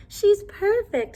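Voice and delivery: high, slow, gushing delight; delighted mother. A narrator's reading. Mother gushing over her newborn baby, high delight.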